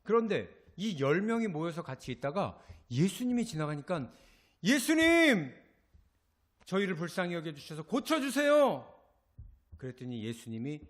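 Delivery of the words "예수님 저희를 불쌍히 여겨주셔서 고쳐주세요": loud, high cry for mercy; pleading cry. Acted. Lepers beg passing Jesus to heal them, loud pleading cry. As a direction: call out urgently to be healed.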